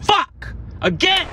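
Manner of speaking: sudden high angry shout; frustrated yell. Real, not acted. Recording failed, frustrated yell.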